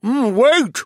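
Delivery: loud burst with mouth full. A narrator's reading. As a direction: blurt it through a mouthful of food. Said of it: "I exploded through a mouthful of eggs".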